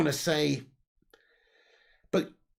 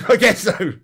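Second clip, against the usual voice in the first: louder, through a burst of laughter; laughing; 4 semitones higher, 10 dB louder than usual. Real, not acted. Streamer answering a viewer about a funny name, laughing. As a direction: crack up while conceding it.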